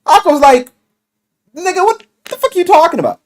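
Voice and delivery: ordinary talk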